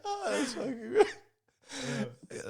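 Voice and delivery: high, slow words squeezed through laughing; helpless laughter. Real, not acted. Reacting to a tweet he loves, words lost in laughter. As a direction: gasp it out while cracking up.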